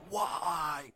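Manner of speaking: high, drawn-out mock yell; aggressive yell. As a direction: re-enact yelling it in someone's face. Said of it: "Same, same aggressive".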